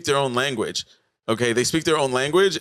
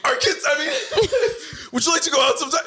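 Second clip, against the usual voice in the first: flustered guy; faster than usual. Retold or imitated.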